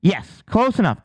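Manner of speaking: usual speaking voice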